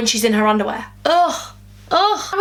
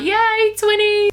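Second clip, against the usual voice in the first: mock cheer; 9 semitones higher, much slower than usual. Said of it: "I'd be like".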